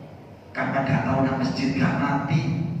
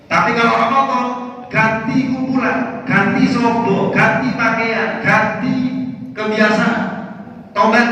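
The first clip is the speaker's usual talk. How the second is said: each ganti stretched slow and high; emphatic preaching; 8 semitones higher, 4 dB louder, slower than usual. Acted. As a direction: hammer every ganti slowly and loudly. Preacher mocks the sudden repentance. (menekankan setiap kata ganti dengan lambat dan tinggi)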